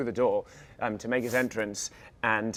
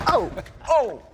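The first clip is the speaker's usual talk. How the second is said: high, loud surprised oh; startled cry; 6 dB louder than usual. Acted. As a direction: caught off guard by the hit. Shield hits him in scene.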